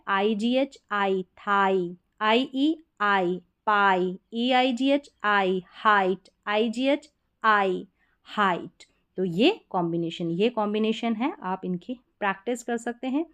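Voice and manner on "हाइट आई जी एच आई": normal talking voice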